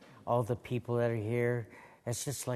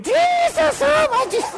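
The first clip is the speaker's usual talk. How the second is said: high, loud mock scream of a shocked congregation; 13 dB louder than usual. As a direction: cry out in hammed-up alarm. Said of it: "People were screaming".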